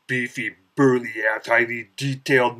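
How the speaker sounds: ordinary talk, nothing marked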